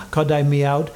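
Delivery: ordinary delivery, nothing marked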